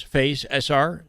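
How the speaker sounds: ordinary talk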